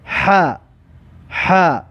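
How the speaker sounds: "ح" as a drilled "ha" from the throat; throaty ha. Shown as a demonstration. Teacher repeats for learners, "ح" as throaty "ha". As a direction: breathe "ح" out through a tightened throat as "ha". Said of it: "ح به حلق".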